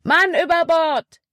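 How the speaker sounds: high, loud alarm call with a falling end. Acted. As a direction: yell it to the whole boat. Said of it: "rief Caleb".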